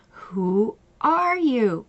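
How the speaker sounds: drawn out slowly, each word landing; slow pointed question. Retold or imitated. Coach repeating the question to client, slow and pointed. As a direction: let the question hang, slowly.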